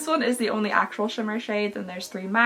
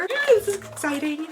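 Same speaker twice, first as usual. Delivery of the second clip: ordinary talk, nothing marked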